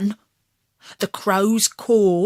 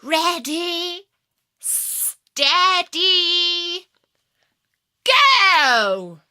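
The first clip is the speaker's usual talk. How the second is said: higher and loud, building to go; calling out the start; 9 semitones higher, 5 dB louder, much slower than usual. A narrator's reading. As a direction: call the race start, rising. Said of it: "the fox called out".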